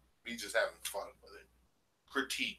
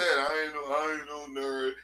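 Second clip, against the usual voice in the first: mock Sa Netta voice; 5 semitones higher, 5 dB louder than usual. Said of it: "Sa Netta even said".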